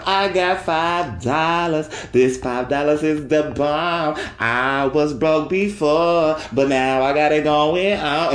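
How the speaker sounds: slow, savoured boast; making it a big deal. Shown as a demonstration. To listeners, showing how to hype five dollars, slow boast. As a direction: stretch it out like treasure. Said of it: "you just make it a big deal".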